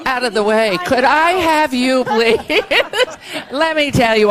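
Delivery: loud, high, laughing words; laughing loudly. Real, not acted. Host laughing while clearing people out of the way, loud and high. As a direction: laugh through the words, shooing people aside.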